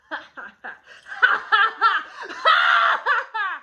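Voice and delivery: loud, high laughing cackle; hammed-up evil laugh; villain laugh. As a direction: cackle after the stab. After stabbing Mercutio.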